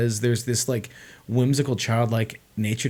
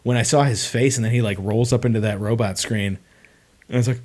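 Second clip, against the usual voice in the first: usual speaking voice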